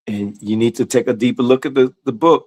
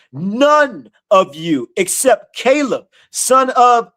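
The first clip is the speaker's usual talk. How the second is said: slow, high, ringing scripture reading; preaching scripture; 6 semitones higher, slower than usual. Acted. Preacher reading God's words to the congregation, slow and ringing. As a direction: proclaim each phrase slowly as the voice of God.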